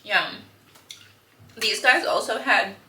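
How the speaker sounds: ordinary talk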